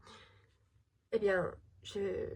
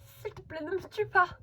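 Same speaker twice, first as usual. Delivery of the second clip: frightened begging; 8 semitones higher than usual. Acted. Girl begging the ghost to spare her, high and frightened. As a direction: beg for your life.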